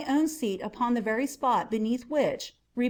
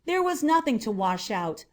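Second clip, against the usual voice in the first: ordinary delivery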